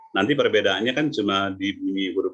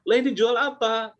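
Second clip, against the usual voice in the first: much higher, disbelieving customer; high baffled shopper; 12 semitones higher than usual. Retold or imitated. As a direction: throw the question up in mock shock. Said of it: "itu dia katanya".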